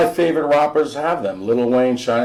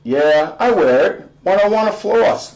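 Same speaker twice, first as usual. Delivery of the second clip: higher, louder smiling brag; dad smiling; 8 semitones higher, 4 dB louder than usual. Acted. Dad boasting to son, higher, louder, smiling. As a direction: grin through the boast. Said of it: "Dad smiles".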